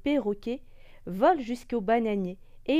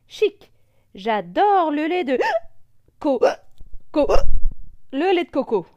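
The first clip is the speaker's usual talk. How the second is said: gleeful voice stumbling on hiccups; delighted; 5 semitones higher, 11 dB louder than usual. Acted. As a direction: squeal with joy through hiccups. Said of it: "se réjouit le perroquet". (ravi, avec le hoquet)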